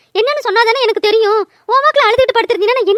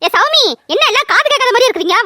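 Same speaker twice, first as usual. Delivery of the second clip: raised voice calling across the house; 4 semitones higher than usual. Acted. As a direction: shout for the girls who won't answer. Mother calling daughters from afar. (தூரத்தில் இருப்பவர்களை உரக்க கூப்பிடுதல்)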